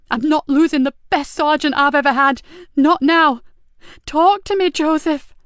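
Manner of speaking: high, loud frantic begging; distraught officer pleading over the wounded; desperate pleading. Acted. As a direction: hold him here with your voice. Nicky pleads with dying sergeant.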